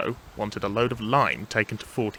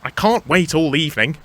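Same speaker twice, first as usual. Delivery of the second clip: higher, louder, exasperated complaint; impatient; 5 semitones higher, 6 dB louder than usual. Acted. Thomas late for his train, impatient with Toby. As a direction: press him to hurry up. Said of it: "interrupted Thomas impatiently".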